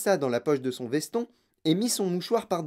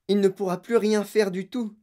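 higher-pitched despair; distressed, raised lament; lamenting wail; 5 semitones higher, 4 dB louder than usual. Acted. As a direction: bemoan it, pitch lifted. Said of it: "se lamenta madame Tivy".

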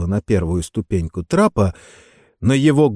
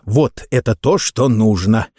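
ordinary delivery

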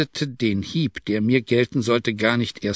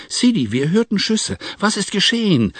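a high, alarmed calling out; anxious call; 9 semitones higher than usual. A narrator's reading. Hadschi arriving after hearing shots, calling out in alarm. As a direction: rush in asking what happened. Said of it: "rief der Hadschi".

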